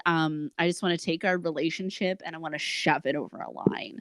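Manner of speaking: ordinary talk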